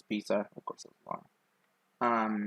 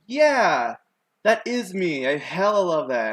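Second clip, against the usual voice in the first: higher and louder, thrilled to be called Scarface; proud excited; 6 semitones higher, 7 dB louder, slower than usual. Retold or imitated. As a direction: blurt it out like you love the name. Proudly accepting the nickname Scarface.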